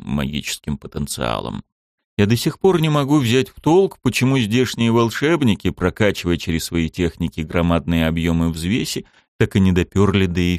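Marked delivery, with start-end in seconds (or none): none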